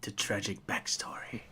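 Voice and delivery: hushed, slow reverence; mock-solemn whisper. Listener recalling the villain's running gag, mock-solemn whisper. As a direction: whisper it as if savoring a familiar tale.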